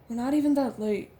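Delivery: Trader's voice. Acted. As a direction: voice Trader with a push.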